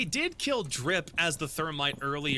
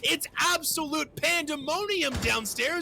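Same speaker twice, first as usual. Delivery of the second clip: live play-by-play; 8 semitones higher than usual. Real, not acted.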